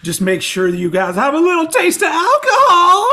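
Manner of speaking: high, loud rock-frontman stage shout. Acted. Giving listeners a clue, mock rock-singer stage rant. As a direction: build into a hammed-up, high concert holler. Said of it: "Is that, is that a [name]?".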